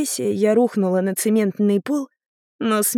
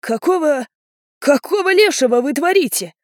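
higher, faltering hoarse outburst; hoarse, dismayed; 6 semitones higher, 4 dB louder than usual. A narrator's reading. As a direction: croak it in shock, struggling for words. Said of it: "прохрипел дядюшка Таруёси". (хрипло, в смятении)

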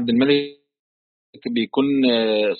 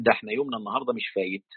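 quick, very soft colloquial aside; muttered dread; 10 dB quieter, faster than usual. Retold or imitated. Teacher putting Lot's worry in everyday words, quick soft mutter. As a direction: mutter it fast, as if foreseeing trouble.

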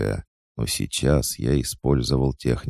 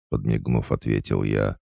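ordinary delivery

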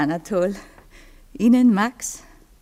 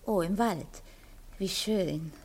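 trance voice; 7 semitones lower than usual. Acted. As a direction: speak as if far away in sleep. Cora speaking in trance.

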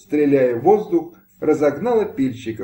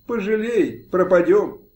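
begging plea; 7 semitones higher than usual. A narrator's reading. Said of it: "Кума упала в ноги".

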